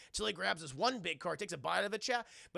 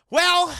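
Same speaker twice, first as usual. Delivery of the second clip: hammed-up exclaim; 12 semitones higher, 17 dB louder than usual. Exaggerated drawn exclamation.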